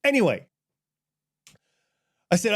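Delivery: ordinary talk, nothing marked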